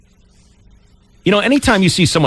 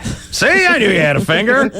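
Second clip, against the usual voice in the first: teasing triumph; 7 semitones higher than usual. Real, not acted. Host teases caller.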